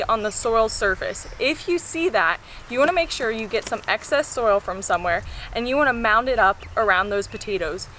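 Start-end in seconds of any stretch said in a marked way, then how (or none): none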